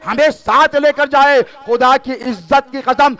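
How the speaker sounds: loud, high pleading sermon; impassioned plea. Real, not acted. Preacher voicing sinners' plea to Husain, fervent.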